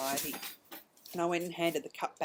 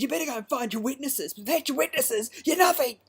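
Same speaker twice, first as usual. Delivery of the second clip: raised, higher voice jabbing at the other driver; angry retort; 8 semitones higher, 9 dB louder than usual. Retold or imitated. Snapping back at the other driver, loud and higher. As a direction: tell them off sharply, voice climbing.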